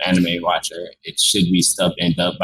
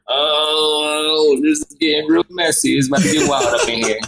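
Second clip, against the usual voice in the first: high-pitched, drawn-out "oh" into eager hype; high mock-excited anticipation; excited reaction; 8 semitones higher than usual. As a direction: retell your hyped reaction, pitched well up. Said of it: "I'm just like".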